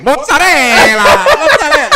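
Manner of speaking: high, loud, stretched Italian imitation; hammed-up singsong scream; mock scream. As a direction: belt it out like a heckler mimicking Italian. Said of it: "in the background screaming like".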